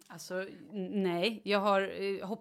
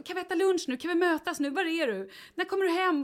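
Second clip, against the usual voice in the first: high, eager mock husband; lightly voiced anxious husband; husband texting wife, voiced high and pleading; 9 semitones higher than usual. Husband's texts read in his voice.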